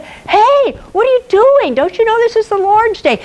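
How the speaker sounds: high, indignant rebuke of a sinner; scolding churchgoer. Acted. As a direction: scold him for working on the Lord's day, pitch up high. Churchgoer scolds Sabbath wood chopper.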